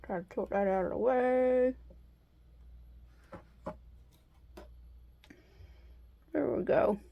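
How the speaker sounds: talking around a straw; words round a straw held in teeth; straw in mouth. Real, not acted. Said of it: "I have a straw in my mouth".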